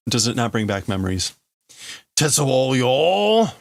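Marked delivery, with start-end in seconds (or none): 2.2-3.5 slow, drawn-out call at a higher pitch; calling to Tetsuo. Acted. Rival calling out to Tetsuo, drawn-out taunt. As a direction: stretch the name out like a taunting rival.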